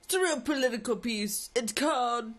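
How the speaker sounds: lightly voiced salesman, title slowed; a hint of the street seller; pushy book seller. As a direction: sell the political piece hard. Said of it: "He's like".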